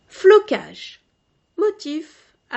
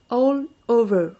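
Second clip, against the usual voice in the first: English accent; 5 dB louder than usual. Shown as a demonstration. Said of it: "mon accent".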